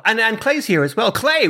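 ordinary delivery

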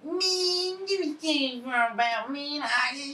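mock San Francisco guy. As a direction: drawl it out high and slow. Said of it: "a guy from San Francisco who's like".

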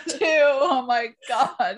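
cracking up at the silly moment, high and breathless; laughing. Real, not acted. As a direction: lose it completely. Both cracking up.

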